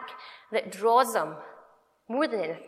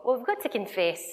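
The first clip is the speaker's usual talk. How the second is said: usual speaking voice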